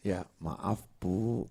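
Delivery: meek apology. Retold or imitated. Suami minta maaf pada istri.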